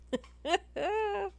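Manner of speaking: squealing high-pitched laughter; high helpless laugh. Real, not acted. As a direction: crack up completely. Cracking up at her accent.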